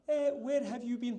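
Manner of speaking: high-pitched mock Queen accent. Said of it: "That's my Queen accent".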